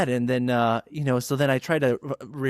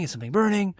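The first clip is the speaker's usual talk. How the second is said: mock-worried, climbing higher on burning; higher, alarmed repeat; 8 semitones higher, faster than usual. Coworker smelling smoke in the store, higher alarmed ask. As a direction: pitch jumps up on the second asking.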